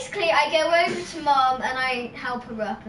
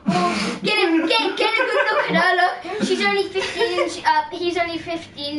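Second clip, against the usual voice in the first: higher, louder half-laughing taunt; imitating the pig's insult back at him; mock merchant jeer; 5 semitones higher, 6 dB louder than usual. Child retells the merchant's taunt, mock jeering. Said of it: "I'll be like".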